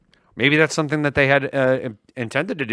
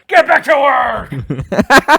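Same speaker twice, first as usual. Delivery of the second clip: high, drawn-out screamed order; very loud, slow, high mock shriek; comic scream; 13 semitones higher, 12 dB louder, much slower than usual. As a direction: scream it like an angry computer tower. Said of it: "the tower would just scream it".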